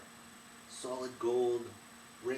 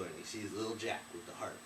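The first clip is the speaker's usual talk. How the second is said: plain reading voice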